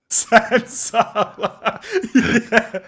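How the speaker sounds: laughing hard, high. Real, not acted. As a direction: laugh through the words. Laughing at a pun.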